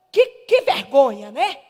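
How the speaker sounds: loud public rebuke, acted out; forceful mock reproach; retold scolding. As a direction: re-enact shaming someone aloud. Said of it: "Falei desse jeito".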